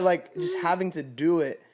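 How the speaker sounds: ordinary talk, nothing marked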